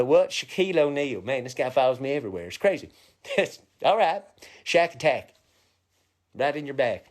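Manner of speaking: normal talking voice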